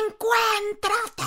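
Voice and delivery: high, stretched-out mock yell; sister's shout. Younger sister sitting her down, high drawn-out mock yell. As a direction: imitate the sister ordering her. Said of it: "Ese grito de tu hermana".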